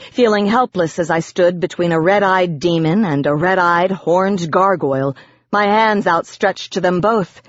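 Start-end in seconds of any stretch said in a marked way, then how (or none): none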